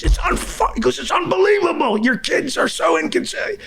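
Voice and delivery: high, squeaky mock neighbor whining; complaining neighbor. Imitating the angry neighbor at his door, high mock whine.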